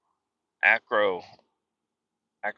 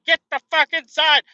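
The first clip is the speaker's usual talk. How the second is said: high, loud yell at barking dogs; 7 dB louder than usual. Retold or imitated. Dad yelling at barking dogs to get inside, loud. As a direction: bark the order to get them in.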